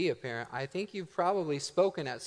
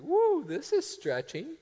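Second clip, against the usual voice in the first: mock overwhelmed; 10 semitones higher, slower than usual. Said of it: "and you're like".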